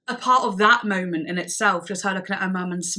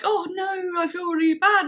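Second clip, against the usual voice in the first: mock dismay; 10 semitones higher than usual. Acting out guilty protest to friend.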